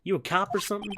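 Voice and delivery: high, excited southern woman; southern accent. Acted. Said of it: "southern drawl".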